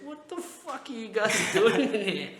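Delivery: slow, high, loud, laughing through the question; laughing mum. Retold or imitated. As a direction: imitate mum bursting out laughing at the scene. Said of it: "she's like".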